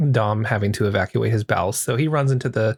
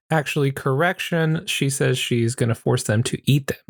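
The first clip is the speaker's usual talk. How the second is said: normal talking voice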